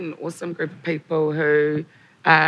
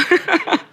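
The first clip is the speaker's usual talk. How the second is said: laughing; 13 semitones higher, 7 dB louder than usual. Real, not acted. Laughing through tears.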